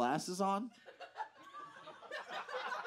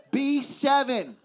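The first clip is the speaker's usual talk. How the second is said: bingo caller; 9 semitones higher, 7 dB louder, slower than usual. Pretend. Joke about bingo caller.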